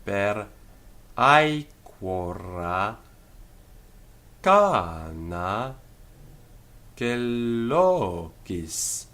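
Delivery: drawn-out Latin verse, syllable by syllable; very slow measured reading, every long vowel held; extremely slow read-through. Shown as a demonstration. Teacher reads Latin verse through for students, extremely slowly. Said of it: "extremely slow".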